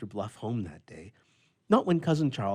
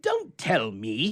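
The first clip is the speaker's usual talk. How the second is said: cried out; 4 semitones higher, 7 dB louder, much slower than usual. A narrator's reading. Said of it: "he cried".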